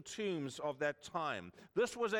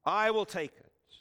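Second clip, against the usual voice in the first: loud, resolved, stress on will; firm loud declaration; 3 semitones higher, 8 dB louder than usual. Retold or imitated. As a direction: call it out to be heard. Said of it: "says three times to be heard".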